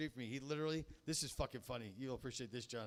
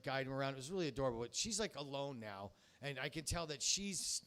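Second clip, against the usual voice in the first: usual speaking voice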